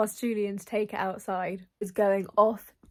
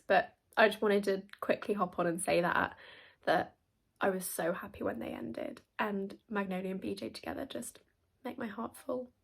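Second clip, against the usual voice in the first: ordinary talk, nothing marked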